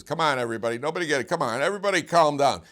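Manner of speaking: family peacemaker calling the room to calm down. Retold or imitated. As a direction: raise the voice over a family quarrel.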